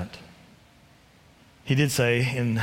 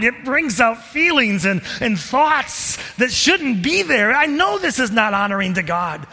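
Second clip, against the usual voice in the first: Paul torn inside, pleading, louder and higher; voicing Paul's struggle; 11 semitones higher, 5 dB louder than usual. Preacher voicing Paul confessing his inner battle, anguished. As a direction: ache over the sin you can't shake. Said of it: "Paul is recognizing".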